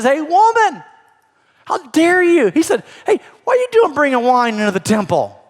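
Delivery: high, loud scolding shout; mock angry rebuke. Old priest Eli scolding the praying woman, loud mock rebuke. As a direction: bark it like an outraged old priest. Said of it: "And he says".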